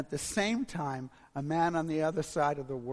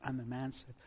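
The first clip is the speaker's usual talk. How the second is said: ordinary talk, nothing marked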